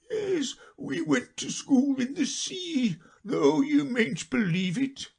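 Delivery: high, slow, mournful voice; a sad creature reminiscing; high slow lament; Mock Turtle.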